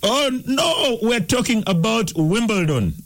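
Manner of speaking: high-pitched exasperated protest. Real, not acted. Quiz host to caller who guessed cricket, exasperated. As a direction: cry out at a wrong answer. Caller gives wrong answer to easy question.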